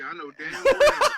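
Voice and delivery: high, very loud laughing exclamation; laughing outrage. Real, not acted. Roaster hammering the refrain, laughing loudly. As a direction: shout it through the giggles.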